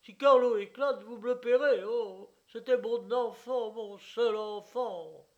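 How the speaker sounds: put-on threaten, then mourn his only child; loud threat turning to grief. Owner threatening, then grieving.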